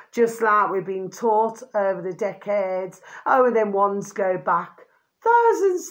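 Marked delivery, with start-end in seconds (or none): 5.2-5.9 thousands jumped way up high and loud; a high incredulous thousands; squealed thousands. Acted. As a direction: shriek thousands in mock disbelief. Mocking how far back claims go.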